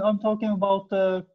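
usual speaking voice

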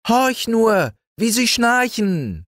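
very high, gleeful cat voice; cat calling down. Acted. Little cat calling down about the sleeping animals, high gleeful voice. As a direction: call it out like a cheeky little cat.

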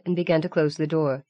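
plain reading voice

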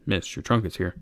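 put-on low, soft cringing old sailor; humble old deckhand, quiet. Decrepit old sailor speaks.

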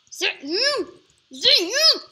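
high, loud sing-song; mock sing-song taunt at an opponent; sing-song call. Rising mock calls.

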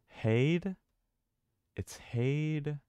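heyed stretched out slowly with the vowel of day; sounding out. Shown as a demonstration. Gamer piecing syllables together from a key, sounding out heyed. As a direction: draw out heyed, tentative and rising. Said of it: "Oh, it's like day".

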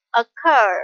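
occur, slow, second syllable stressed. Shown as a demonstration. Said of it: "with the stress on the second".

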